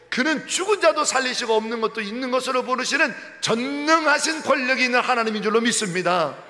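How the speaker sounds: loud, climactic proclamation; full-force preaching. Real, not acted. Preacher to congregation, loud climactic declaration. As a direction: declare it with rising power.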